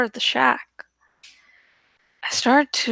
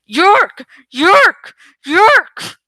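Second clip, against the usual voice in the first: piercing screeched cries, much louder, rising each time; frenzied louder screams climbing higher; screaming; 4 semitones higher, 9 dB louder, much slower than usual. A narrator's reading. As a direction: shriek it like a mad old woman attacking. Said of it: "and screaming".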